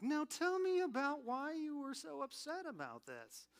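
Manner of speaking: a light take on the Dateline host's probing voice; Josh Mankiewicz imitation. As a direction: coax the confession like a TV detective host. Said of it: "that guy Josh's voice".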